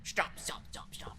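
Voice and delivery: urgent whispered stop; whispering. Real, not acted. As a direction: hiss it under your breath. Urgent hushed pleading.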